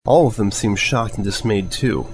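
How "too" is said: plain reading voice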